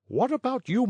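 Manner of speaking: high polite coaxing; wheedling. A narrator's reading. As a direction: coax him sweetly. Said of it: "asked the little man, turning politely".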